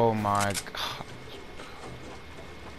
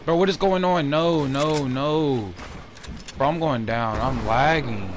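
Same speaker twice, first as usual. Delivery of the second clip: loud and higher, panicked yelling; frantic shouts at the lagging game; hyped gamer bro; 5 semitones higher, 5 dB louder than usual. Real, not acted. Lag spike mid-fight, panicking.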